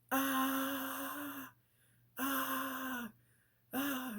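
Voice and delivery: very high, long screams; acted waking scream. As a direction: scream as if jolted awake from a nightmare. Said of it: "waking up screaming".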